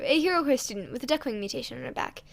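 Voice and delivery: plain reading voice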